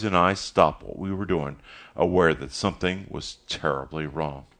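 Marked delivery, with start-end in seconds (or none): none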